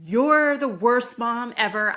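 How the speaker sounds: loud, drawn-out mock outburst; angry child's outburst. Kid lashing out at mom, angry outburst.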